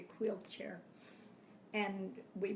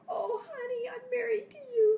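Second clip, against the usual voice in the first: mock tearful wail; 12 dB louder than usual. Wife to husband who forgot her, exaggerated tearful wail. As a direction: wail it imploringly, hammed-up.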